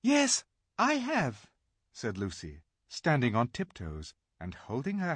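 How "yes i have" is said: put-on much higher, slow little girl; lightly voiced eager young girl. A narrator's reading. Lucy answers the giant.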